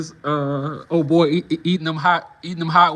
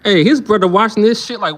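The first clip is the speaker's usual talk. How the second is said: ordinary talk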